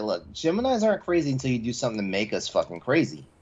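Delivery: normal talking voice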